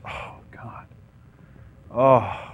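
breathy mock-despair sigh; theatrical exasperated sighing; fake exasperation. Shown as a demonstration. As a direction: exhale hard, as if utterly fed up. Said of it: "Fake exasperation".

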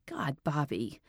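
whispered in shock. Acted. Detective stunned by the killing, whispered in shock. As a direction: breathe it out, stunned.

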